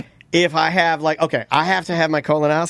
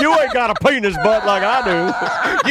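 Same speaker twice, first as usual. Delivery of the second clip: mock dumb voice; 3 semitones higher, slower than usual. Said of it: "Tom's like".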